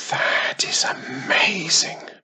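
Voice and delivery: slow awed whisper. A narrator's reading. Wiz whispers in awe at the baby dragon. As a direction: breathe it in wonder. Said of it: "whispered Wiz".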